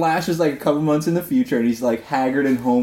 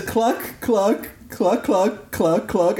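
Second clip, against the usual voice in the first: chicken clucking; 5 semitones higher, much slower than usual. Retold or imitated. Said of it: "And it just says".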